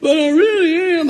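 put-on high little-boy voice, laughing-crying; child's plea. Grandson insisting he is sorry, high teary child voice. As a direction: whimper it like a caught kid.

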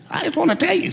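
high-pitched parrot impersonation. Acted. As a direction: confide it in a talking bird's high voice. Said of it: "and the parrot said".

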